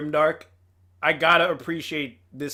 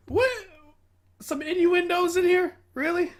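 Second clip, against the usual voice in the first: pitched way up, incredulous at the innuendo; squeaky disbelief; mock surprise; 13 semitones higher than usual. Real, not acted. Reacting to dirty innuendo in the script, surprised high squeak. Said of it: "reacting to innuendo".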